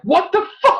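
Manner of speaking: high, stunned what the fuck; shocked outburst. Retold or imitated. Watching the movie in the theater, a high shocked outburst. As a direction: blurt it out in disbelief. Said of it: "I'm like".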